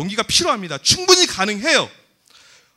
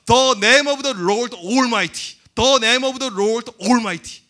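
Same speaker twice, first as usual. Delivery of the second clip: very slow, weighty English recitation; proclaimed English verse; 4 dB louder, much slower than usual. Shown as a demonstration. Preacher quoting the English Bible to youths, solemn proclamation. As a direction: draw out each word of the verse.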